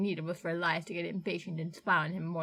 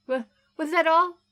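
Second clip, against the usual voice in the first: higher, louder, catching on the first word; frightened stammer; 8 semitones higher, 8 dB louder than usual. Acted. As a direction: ask it scared, hoping they leave. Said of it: "tentatively took the package".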